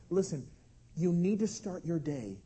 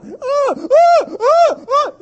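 high, loud bursts of infant wailing; mock newborn screams; imitated baby screeching; 14 dB louder, much slower than usual. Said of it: "screaming with the screeches".

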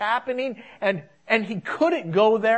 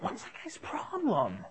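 much softer acted aside; mock grumble under the breath; muttered annoyance; 3 semitones higher, 10 dB quieter than usual. Plane passenger turning round about the noise, muttered aside.

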